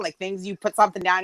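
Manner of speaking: ordinary delivery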